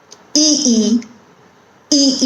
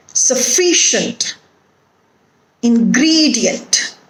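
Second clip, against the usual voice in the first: word list with a beat on the pre-suffix syllable, "ingredient" as in-GRE-dient; stress before suffix. Shown as a demonstration. Teacher giving examples of a stress rule, stress before suffix. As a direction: stress the syllable just before each ending, as in "Sufficient": suf-FI-cient. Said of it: "the stress falls on the syllable".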